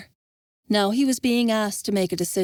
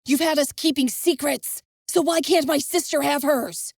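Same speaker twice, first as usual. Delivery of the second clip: higher, accusing challenge; demanding; 5 semitones higher than usual. Acted. Devon confronting Envis, demanding. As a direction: call him a hypocrite to his face. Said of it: "Devon demanded".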